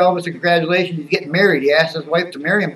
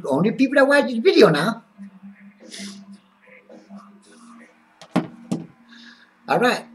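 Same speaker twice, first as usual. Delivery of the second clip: put-on character voice, pitched up and swinging; Skip. Skip chatting to viewers about wrestling, put-on excitable character voice. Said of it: "So, so it's Skip now".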